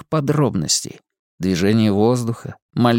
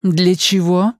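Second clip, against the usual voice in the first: woman's voice; 7 semitones higher, 3 dB louder, slower than usual. Acted. Simona alarmed.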